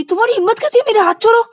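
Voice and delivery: very high, loud order; outraged demand. Acted. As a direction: let go of my hand. Rani demands release. (तीखा गुस्सा)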